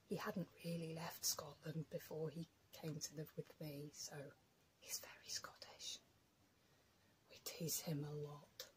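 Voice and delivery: telling viewers about her husband in a whisper; whispering aside. Real, not acted. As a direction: lean in and whisper behind his back. Talking about husband behind back.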